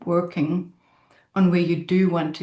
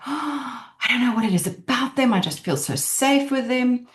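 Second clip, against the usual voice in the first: dreamy and content; 6 semitones higher than usual. Retold or imitated. Voicing someone describing feeling safe.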